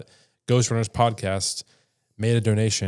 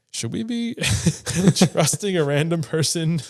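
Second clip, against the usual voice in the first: higher-pitched, laughing mock hesitation; laughing doubt; 5 semitones higher than usual. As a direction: voice a sceptical thought through laughs. Voices own doubts while laughing.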